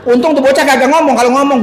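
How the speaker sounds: ordinary talk, nothing marked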